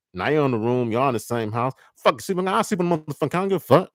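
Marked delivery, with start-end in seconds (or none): 2.0-3.9 much higher, spiteful "I don't give a fuck"; angry dismissal. Acted. Partner storming off to sleep elsewhere, angry. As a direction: storm off mid-fight.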